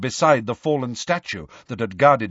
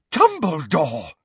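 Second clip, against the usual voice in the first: slow, higher cry of amazement; drawn-out astonished exclamation; amazed gasp; 5 semitones higher, slower than usual. A narrator's reading. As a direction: gasp the name, utterly amazed. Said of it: "gasped Fudge, beside himself with amazement".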